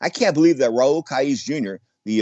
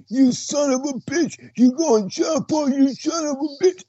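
much higher, slow mock yell; angry sparring partner; slower than usual. Sparring partner mocked for going southpaw, hammed-up higher shout. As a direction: imitate a sparring partner cussing him out, slowed and shouting. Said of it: "that's what he would say".

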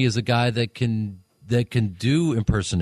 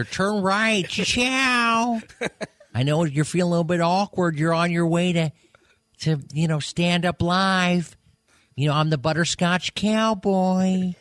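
Owen Wilson; 7 semitones higher than usual. Acted. Comedian as a celebrity satnav giving directions, high drawled impression. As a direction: stretch the vowels in a higher lilting voice. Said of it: "requested Owen Wilson as Lightning McQueen".

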